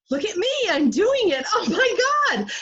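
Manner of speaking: high, loud delight at her own daring; thrilled self-cheer. Retold or imitated. Cheering herself on halfway across the wire, high and loud. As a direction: crow it like a kid showing off.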